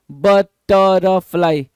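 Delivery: बटरफ्लाई slowly broken into separate syllables, firm and loud. Shown as a demonstration. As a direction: stretch बटरफ्लाई out, one syllable at a time. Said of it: "word को आप टुकड़ों में बांट दे रहे हो". (बटरफ्लाई को ज़ोर से, धीरे-धीरे सिलेबल तोड़कर)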